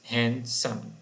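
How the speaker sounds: "handsome" counted out as "hand... some"; "handsome" in two separate beats; syllable by syllable. Shown as a demonstration. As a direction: pause between the halves of "handsome".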